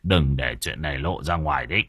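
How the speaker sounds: low, restrained warning; low-pitched, understated command; grim husband. A narrator's reading. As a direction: order secrecy in a low man's voice. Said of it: "Chú lẳng lặng đứng dậy".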